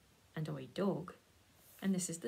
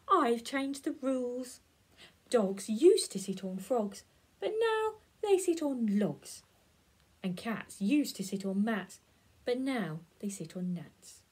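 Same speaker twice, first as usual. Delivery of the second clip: bossy frog; 3 semitones higher, 7 dB louder than usual. Acted. Frog lectures cat and dog on his rules, loud and smug. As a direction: announce the rules like a pompous little boss.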